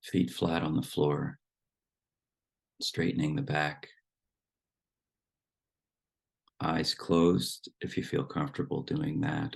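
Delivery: unhurried meditation guidance with long silences; guided meditation. Acted. Teacher settles the group into relaxed breathing, guided meditation voice. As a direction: ease them into stillness, phrase by phrase.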